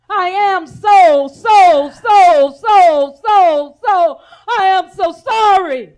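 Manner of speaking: wailing real sorrow, half laughing through tears; breaking down sobbing. Real, not acted. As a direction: cry out the apology, overwhelmed. Apology breaking into crying.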